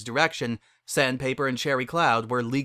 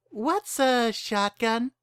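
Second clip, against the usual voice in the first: much higher, slow, wide-eyed innocence; naive young mare; 11 semitones higher, much slower than usual. Acted. The innocent mare asks Barry a puzzled question, much higher. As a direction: ask it like you've never heard the word.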